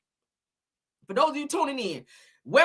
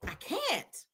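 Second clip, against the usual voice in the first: ordinary talk, nothing marked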